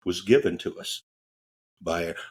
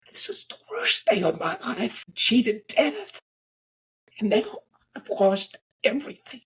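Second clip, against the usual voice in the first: high, sobbing, broken voice; crying in despair; 12 semitones higher than usual. Retold or imitated. Crash survivor sobbing on the ground in despair. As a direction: wail it out, voice cracking with tears. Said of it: "and he's crying".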